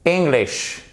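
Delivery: English begun with a stressed "ing", high then falling; correct English. Shown as a demonstration. Teacher revealing the answer, correct English. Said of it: "Et pas English ou English".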